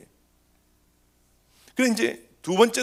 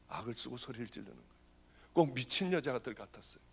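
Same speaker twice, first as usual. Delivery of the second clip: hushed telling; 6 semitones lower, 11 dB quieter than usual. Real, not acted. Hushed description of screaming woman.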